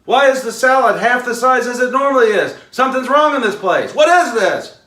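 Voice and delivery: loud, high, demanding customer complaint; angry outburst. Shown as a demonstration. Demo for the audience of a customer yelling at the manager, loud and high. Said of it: "That, ladies and gentlemen, is a reaction".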